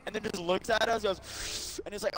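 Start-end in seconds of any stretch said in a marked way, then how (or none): none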